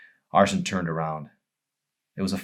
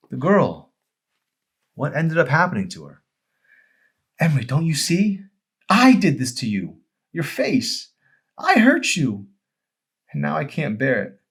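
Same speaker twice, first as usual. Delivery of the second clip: anguished; 8 semitones higher, 7 dB louder than usual. Acted. Arson's realization and anguish.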